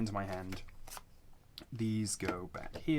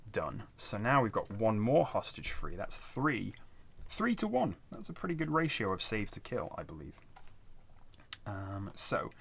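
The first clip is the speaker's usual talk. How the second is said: usual speaking voice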